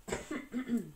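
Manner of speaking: short dry cough; coughing fit. Real, not acted. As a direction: cough between words. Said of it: "Excusez-moi, je tousse un peu".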